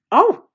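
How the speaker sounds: sharp, high, loud yelp of surprise; startled high yelp. Acted. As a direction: yelp as it hits you. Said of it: "I yelped".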